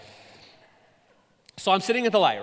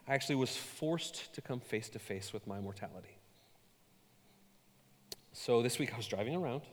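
ordinary delivery

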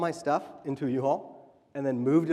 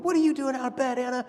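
exasperated dad; 9 semitones higher, 5 dB louder, faster than usual. Retold or imitated. Dad scolding Anna.

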